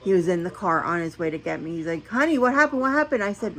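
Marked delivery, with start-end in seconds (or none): 2.1-3.2 retold anxious husband, higher; concerned honey on the phone; worried husband. Husband calling wife about her dead phone, mildly worried voice.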